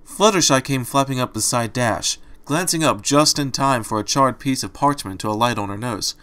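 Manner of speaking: ordinary delivery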